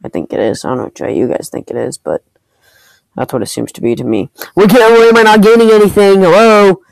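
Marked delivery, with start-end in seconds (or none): 4.5-6.8 high, loud frustrated outburst; exasperated gamer rant, raised voice; mad. Real, not acted. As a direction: snap in real anger at the game. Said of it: "Sorry, guys, I'm mad".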